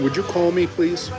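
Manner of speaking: usual speaking voice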